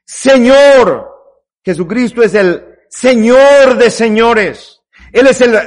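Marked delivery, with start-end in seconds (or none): 0.0-1.1 fervent cry to the Lord. Acted. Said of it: "mire cómo se dirige a él".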